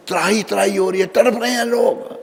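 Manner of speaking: high-pitched cry of the suffering; anguished outcry. Acted. Preacher evoking souls writhing in hell, high anguished cry.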